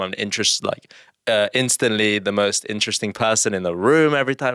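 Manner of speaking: normal talking voice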